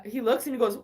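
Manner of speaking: ordinary talk, nothing marked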